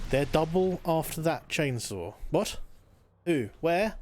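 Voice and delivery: ordinary delivery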